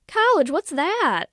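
high, slow, loud Southern belle; hammed-up Southern accent, playing dim; mocking Southern accent. A narrator's reading. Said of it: "She's still doing the accent".

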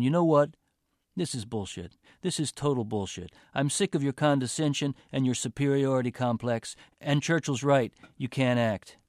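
loud angry outburst. Acted. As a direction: lash out at her. Boyfriend explodes in a fight.

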